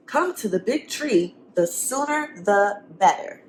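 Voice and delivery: lively, sing-song storybook reading; reading to children. A narrator's reading. Teacher reading a picture book to the class. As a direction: read it to the kids with rhyme.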